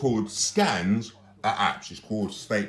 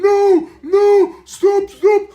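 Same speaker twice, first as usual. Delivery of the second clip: a shrill, very high mock pleading voice, much louder; high squeaky imitation; 12 dB louder, much slower than usual. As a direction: squeal it like a frightened woman begging.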